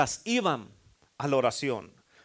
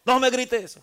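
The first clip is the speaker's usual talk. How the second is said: shouting back; 7 semitones higher, 6 dB louder, slower than usual. Retold or imitated. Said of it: "te grita".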